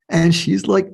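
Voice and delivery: normal talking voice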